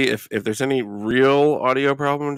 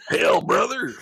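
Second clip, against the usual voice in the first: mock American accent; 8 semitones higher than usual. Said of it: "Why can't you do an American accent".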